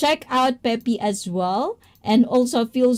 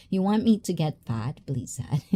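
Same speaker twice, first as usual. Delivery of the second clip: usual speaking voice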